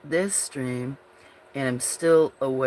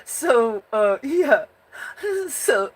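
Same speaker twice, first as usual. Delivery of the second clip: words stretched out through a yawn; 10 semitones higher, 4 dB louder, slower than usual. Real, not acted. Streamer talking to viewers through a slow yawn. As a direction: talk while still mid-yawn.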